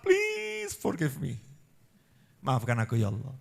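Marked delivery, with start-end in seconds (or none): 0.0-1.4 high, loud excited cry to God; joyful plea. Acted. Preacher acting a believer crying out thanks and pleading.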